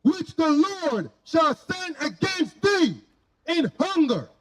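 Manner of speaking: normal talking voice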